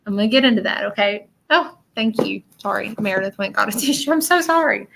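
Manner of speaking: ordinary talk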